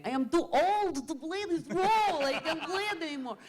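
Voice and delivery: plead, worn out by the role; Irina complaining. Retold or imitated. Actress imitating Irina pleading to the director, high and loud. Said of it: "Irina was saying".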